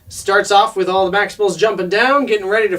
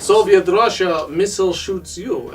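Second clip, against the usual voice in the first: put-on Russian accent. Ravage answers Rampage's missiles, Russian accent. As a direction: land the joke in hammed-up Russian. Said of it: "he's said in a Russian accent".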